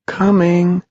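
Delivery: put-on high child's call; distant cry. A narrator's reading. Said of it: "was the cry".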